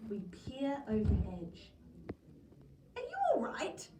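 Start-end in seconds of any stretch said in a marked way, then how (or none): 3.0-3.9 high called-out shout. A narrator's reading. Director shouting down to Terry in the shark tank. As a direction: call down into the tank. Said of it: "shouts Mister Big Shot".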